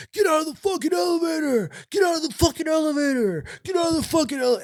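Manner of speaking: very high, strained mock yell; high-pitched repeated bellow, no real volume; imitated yelling. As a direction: mimic an inmate screaming orders. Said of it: "he just starts yelling".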